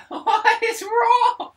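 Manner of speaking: squealing with laughter; high laughing shriek. Real, not acted. Laughing shock at friend's sneezes.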